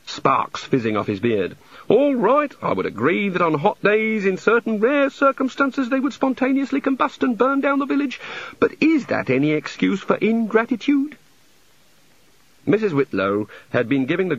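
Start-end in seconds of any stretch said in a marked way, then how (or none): 1.9-11.2 high, fretful voice of a flustered god; a god embarrassed by his own temper; apologetic god. Acted. God voice continues.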